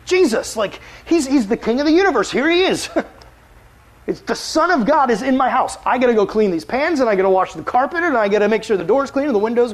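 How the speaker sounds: high, loud, flustered hostess voice; mock-frantic Martha fretting over the house. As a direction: panic about the perfect house. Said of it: "she's, like, freaking out".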